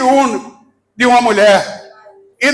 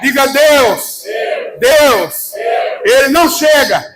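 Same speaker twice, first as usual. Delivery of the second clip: fiery preaching. Acted. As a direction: preach it full force to the crowd. Preacher fires up congregation.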